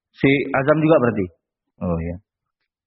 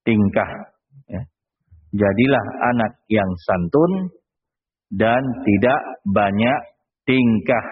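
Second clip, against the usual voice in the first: slow dictation; 5 semitones lower, much slower than usual. Shown as a demonstration. Dictating the lesson line slowly.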